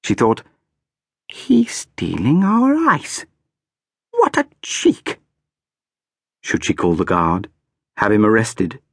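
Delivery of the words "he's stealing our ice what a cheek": put-on high, slow, indignant child voice; a small duchess outraged at a thief; indignant little girl. A narrator's reading. Girl's indignant thought voiced.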